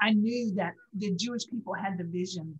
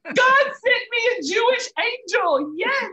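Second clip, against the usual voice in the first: much higher and louder, slow and exultant; joyful exclaim; 12 semitones higher, 9 dB louder, slower than usual. Real, not acted. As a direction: burst out with joy and wonder. Overjoyed retelling of divine meeting.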